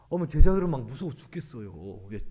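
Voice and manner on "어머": ordinary talk, nothing marked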